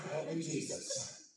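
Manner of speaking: low, slow, soft re-enacted prayer; muttered plea. Pastor asking for help over the bill, muttered plea. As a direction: slowly beg for help under your breath.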